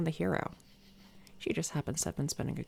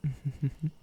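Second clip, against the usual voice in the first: low, closed-mouth chuckle; short smug chuckle in a run of pulses; villain's chuckle; 3 semitones lower than usual. Acted. Villain amused by obedient captive.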